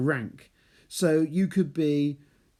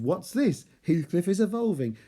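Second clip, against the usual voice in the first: higher and excited, game announcement; playful mock game alert; mock Pokemon evolving; 5 semitones higher than usual. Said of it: "It's like Pokemon".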